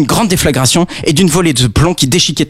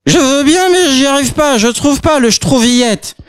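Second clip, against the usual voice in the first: put-on high, slurred fumbling girl; drunk girl voice; 11 semitones higher than usual. Drunk girl failing to open the door, high slurred voice. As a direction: slur it while fumbling.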